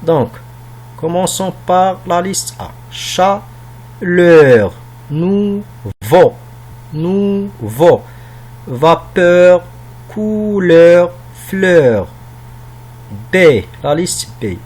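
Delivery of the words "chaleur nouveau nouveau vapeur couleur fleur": word-list reading, each word drawn out and separate; careful pronunciation. Shown as a demonstration. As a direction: pronounce each word fully for pupils, leaning on the endings. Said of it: "de prononcer bien ces mots". (prononciation soignée, mot par mot)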